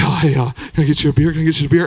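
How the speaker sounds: low talker. Retold or imitated. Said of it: "He was just like".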